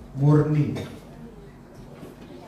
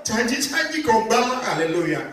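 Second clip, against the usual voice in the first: high, whiny mock voice; hammed-up high complaining voice; mock jilted woman; 10 semitones higher, slower than usual. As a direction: imitate a girl complaining about empty promises. Mocking a jilted churchgoer.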